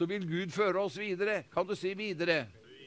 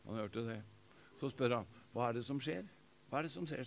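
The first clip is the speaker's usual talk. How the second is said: soft, much quieter retelling; hushed storytelling; 6 semitones lower, 11 dB quieter, faster than usual. A narrator's reading. Pastor telling the crowd the story, hushed and soft. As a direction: draw them in with a hushed voice.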